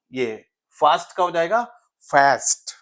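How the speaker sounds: fast said with a flat short a; American fast. Shown as a demonstration. Teacher contrasts British and American fast. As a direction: flatten the a in fast, American style. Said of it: "fast becomes fast".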